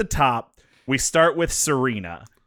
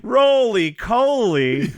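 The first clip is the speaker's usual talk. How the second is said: drawn-out name; 9 semitones higher, much slower than usual. Acted. Savoring the name.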